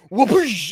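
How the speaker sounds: sudden rushing-water noise; imitated water whoosh. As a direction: mimic a big splash of water dumping down. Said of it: "It was just like a".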